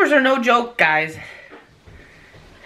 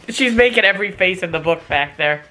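ordinary talk, nothing marked

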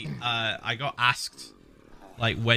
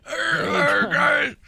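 put-on old person voice; 4 dB louder, slower than usual. Copying the old person bit.

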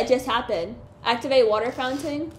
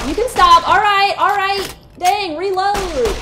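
loud, high yelled protest; frustrated shout; 7 semitones higher, 9 dB louder than usual. Real, not acted. Player yelling at an enemy to stop attacking, loud. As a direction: yell at the enemy to quit.

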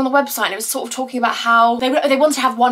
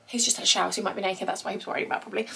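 hushed aside; 3 semitones lower, 11 dB quieter, much faster than usual. Real, not acted. Aside about partner just showered.

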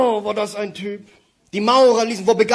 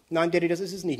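glum quiet son; 8 dB quieter, faster than usual. Acted. Son voiced in a joke.

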